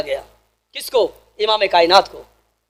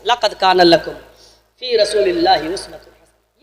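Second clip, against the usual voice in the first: Quranic recitation; 9 semitones lower, 5 dB quieter than usual. Acted.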